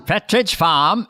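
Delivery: slow, r-dropping New England accent; drawn-out New England accent. Shown as a demonstration. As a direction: say FARM as "fahm", New England style. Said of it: "You can get it in one word".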